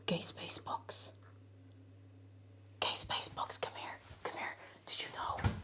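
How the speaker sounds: hushed secret whisper to chat; conspiratorial whisper before the song. Pretend.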